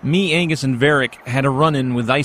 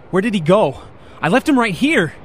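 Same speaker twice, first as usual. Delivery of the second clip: panicking; 6 semitones higher than usual. Acted. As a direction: start to panic that he's gone. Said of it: "Calm down".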